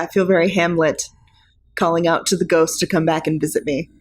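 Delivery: ordinary talk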